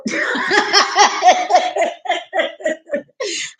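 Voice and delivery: long, high cackling laugh; real laughter. Real, not acted. Cracking up after saying observe and report, real laughter. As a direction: burst out laughing, high and rapid, then taper off.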